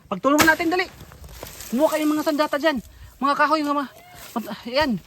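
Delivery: loud frantic commands; urgent shouting. Real, not acted. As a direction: call them to grab weapons. Urgent calls during chase. (malakas na utos sa kaibigan)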